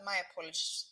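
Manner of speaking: normal talking voice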